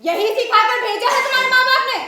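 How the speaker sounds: angry scolding. Acted. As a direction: scold her loudly for talking back. सास बहू पर भड़कती है. (गुस्से में ज़ोर से डाँटती सास)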